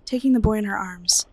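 ordinary delivery, nothing marked

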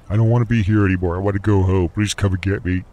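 high, loud, fast pleading plea; desperate begging. Acted. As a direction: beg loudly like a broken man who wants to go home. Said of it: "I keep begging".

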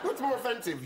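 normal talking voice

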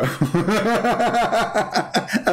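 high, rapid imitated cackle; mocking laugh. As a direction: imitate a caller laughing in mockery. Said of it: "he was laughing at Jesus".

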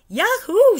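loud, high whooping yahoo; yell. A narrator's reading. Miss Frizzle yelling as she jumps down the tube. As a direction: whoop it out with joy. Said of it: "she yelled".

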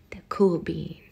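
breathy hushed echo of the title; whispered title. Shown as a demonstration. Reader whispering the title to the listener. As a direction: whisper the title back like a secret. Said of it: "whispered title echo".

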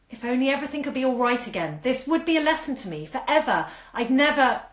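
rising anguish spilling out; desperate fragments. Acted.

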